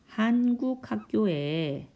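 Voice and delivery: slow dictation with long pauses; drawn-out words, long gaps between phrases; slow writing-along pace. Real, not acted. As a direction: say each phrase slowly as you write it out. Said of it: "쓰려니까 시간이 많이 걸리네요".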